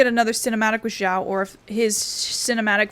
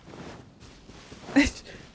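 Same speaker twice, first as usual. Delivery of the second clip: short unvoiced laugh of disbelief; breathy laugh; 6 dB quieter than usual. Real, not acted. Streamer amused by an odd game moment, breathy laugh.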